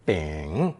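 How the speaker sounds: bing in a low dipping third tone. Shown as a demonstration. Teacher showing the new character, bing in third tone. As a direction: draw out bing, dipping low then rising. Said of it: "Pronounced third tone".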